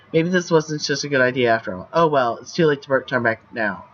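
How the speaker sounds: ordinary delivery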